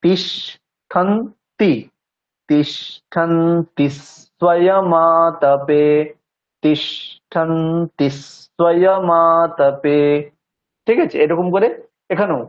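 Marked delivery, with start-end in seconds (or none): 0.0-10.0 very slow তিষ্ঠন্তি স্বয়মাতপে, stressing the first letter of each cluster. Shown as a demonstration. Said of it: "টানা বলে গেলে চলবে না". (তিষ্ঠন্তি স্বয়মাতপে খুব ধীরে, যুক্তাক্ষরের প্রথম বর্ণে জোর)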